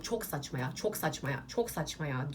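low, offhand brush-off repeated three times; dismissive quip. Retold or imitated. Vlogger demos how she ends every story, dismissive and low. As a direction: wave it away as nonsense. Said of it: "her mevzunun sonunda böyle şey yapıyorum".

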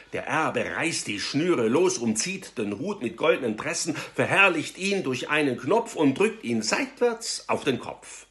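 ordinary delivery

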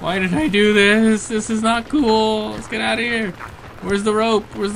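panicked whine. Real, not acted. Panicked gamer in a cave.

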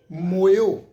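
ਮੋਇਓ said loudly as mo-yo; correct pronunciation. Shown as a demonstration. Teacher stressing the correct reading of ਮੋਇਓ. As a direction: say ਮੋਇਓ clearly with a loud mo-yo. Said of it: "ਇਹਦਾ ਪਾਠ ਉਚਾਰਨ ਹੋਏਗਾ".

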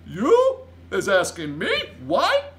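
loud, high, slow giant question; drawn-out giant disbelief; incredulous cry. A narrator's reading. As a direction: throw the question back, astonished. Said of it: "cried the B F G".

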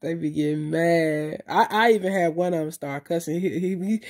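ordinary talk

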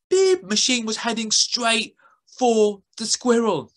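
ordinary delivery

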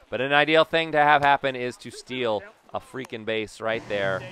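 ordinary delivery

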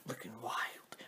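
soft unvoiced whisper. Real, not acted. Showing off outfit to camera in a whisper. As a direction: breathe it like a secret. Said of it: "whispered aside".

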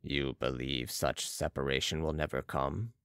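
low, soft character voice; Balsamo questioning. A narrator's reading. Balsamo questions Marat's theory, low and measured. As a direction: pitch it well down, quietly questioning.

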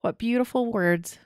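moved almost to tears; choking up. Real, not acted. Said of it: "I'm choking up".

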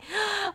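high, soft intake of breath; delighted gasp. Real, not acted. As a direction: gasp in happy surprise.

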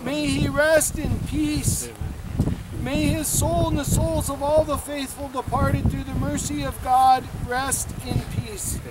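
ordinary delivery

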